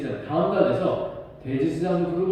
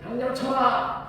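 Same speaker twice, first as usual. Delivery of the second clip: high, slow commanding order; high priest's order; 8 semitones higher, slower than usual. Retold or imitated. High priest ordering Paul struck, commanding. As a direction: order him struck, drawn out. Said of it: "옆에 있는 사람한테 말을 해요".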